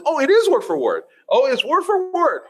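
high, exaggerated surprise at the discovery; hammed-up amazement; mock surprise. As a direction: act amazed it matches. Feigned discovery of the verse.

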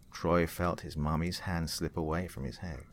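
ordinary delivery

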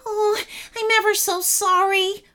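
high-pitched whimpering apology; whimpering Clunky. A narrator's reading. Caged Clunky apologises to the dragon, whimpering. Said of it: "whimpered Clunky".

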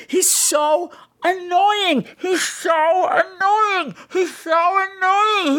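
high, slow, whining wail; mock whiny wail. Mocking the annoying brother in a high whiny wail. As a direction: drag it out like a fake sob.

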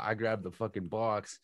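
ordinary talk, nothing marked

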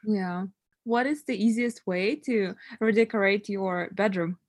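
ordinary talk, nothing marked

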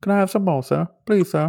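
high, softly pleading British urchin; put-on British beggar-child voice; mock British accent. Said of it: "we should practice our accents".

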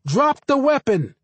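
high, loud called command; police shout. Acted. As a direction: shout the order across the street. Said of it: "the voice called".

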